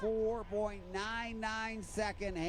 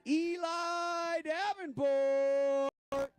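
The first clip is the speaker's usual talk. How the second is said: long drawn-out shout of the name; excited call; 10 semitones higher, 5 dB louder, much slower than usual. Real, not acted. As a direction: stretch the name out, big and loud. Announcer calling the winner.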